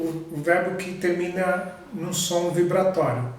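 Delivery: ordinary delivery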